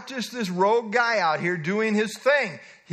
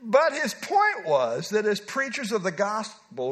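ordinary delivery

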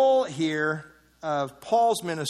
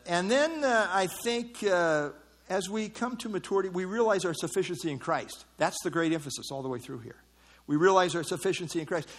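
normal talking voice

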